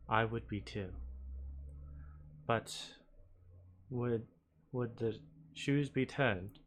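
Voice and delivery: soft, measured reasoning with long pauses; gentle in-character appeal. Acted. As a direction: reason gently with someone reluctant. Player voices Lesla pleading with spirit.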